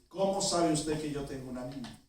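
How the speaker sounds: softly voiced wary question; serious, almost angry, scared. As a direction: ask it seriously, with a touch of alarm. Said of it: "así bien seria, casi enojada, asustada". (pregunta seria y baja, algo asustada)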